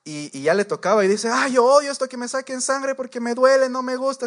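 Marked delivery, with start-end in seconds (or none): 1.3-4.3 higher and louder whiny protest; mock whining complaint. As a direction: whine about the needle like a fussy patient. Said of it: "la señora más mala actitud". (queja quejumbrosa, más aguda y fuerte)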